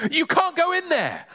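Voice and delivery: high-pitched mild yell; alarmed boy blocking the way. Acted. Boy stops neighbour at living room door, high yell. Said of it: "yelled Ben".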